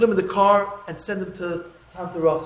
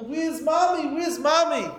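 high, pleading repeated question; a lost child begging to know; crying for mommy; 8 semitones higher, slower than usual. Retold or imitated. Boy searching the house for his dead mother, high pleading cry. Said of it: "he's crying hysterical".

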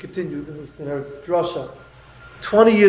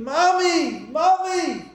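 high, desperate call of Mommy; a child searching the house, calling Mommy; 10 semitones higher, much slower than usual. Retold or imitated. Rabbi retelling a five-year-old searching the house, high desperate call.

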